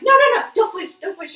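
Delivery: high, loud, rapid-fire pleading; retold urgent command. Mother imitating her midwife on the phone, loud and urgent. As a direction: urgently stop someone, re-enacted. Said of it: "you're like".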